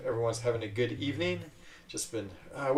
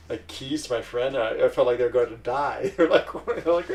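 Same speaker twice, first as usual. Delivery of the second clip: usual speaking voice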